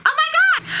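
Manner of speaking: very high, giddy squeal; squealing excitement. Shown as a demonstration. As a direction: squeal it like great news. Demo series of OMG variants.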